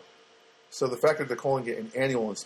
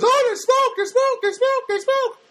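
panicky smoke alarm; 7 dB louder than usual. Pretend. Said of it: "It goes".

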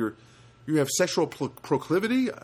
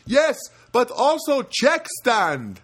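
put-on loud, high, slow Filipino-accented clerk; emphatic mock Filipino accent; 11 semitones higher, 6 dB louder, much slower than usual. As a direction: drag it out in an imitated Filipino accent. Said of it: "that's a good Filipino accent".

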